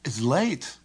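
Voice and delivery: surprised realisation with a pitch jump; taken aback; surprise. Shown as a demonstration. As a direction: say it as a startled discovery.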